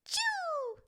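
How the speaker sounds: very high cute chirp; high happy creature chirp; joyful Chao cry. A narrator's reading. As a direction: squeak happily like a tiny pet. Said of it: "the Chao joyfully replies".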